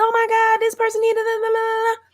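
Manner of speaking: very high-pitched mocking complainer; mock outrage. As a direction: parody someone wailing in outrage, way up high. Mocking other people's outraged complaints.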